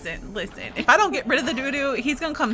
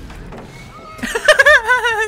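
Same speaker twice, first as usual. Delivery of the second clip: loud burst of laughter; 12 semitones higher, 8 dB louder than usual. Real, not acted. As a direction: crack up hard.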